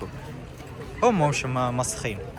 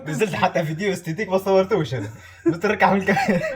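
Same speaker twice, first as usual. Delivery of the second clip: laughing; 5 semitones higher than usual. Real, not acted. Laughing at the camera.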